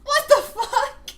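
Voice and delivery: high, stretched laughing disbelief; laughing shout. Real, not acted. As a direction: shriek it through a laugh. Stunned by caller.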